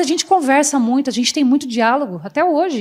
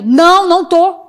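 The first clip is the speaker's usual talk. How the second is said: louder, higher, emphatic denial; negação veemente; 4 semitones higher, 5 dB louder than usual. Retold or imitated. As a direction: deny the husband's accusation firmly, re-enacted. Nega ao marido que esteja traindo. (negação enfática, mais alta e aguda)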